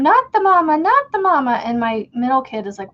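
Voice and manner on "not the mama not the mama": high, loud sing-song cartoon baby voice; Not the mama catchphrase. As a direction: belt it like the TV dinosaur baby. Quoting dinosaur show catchphrase.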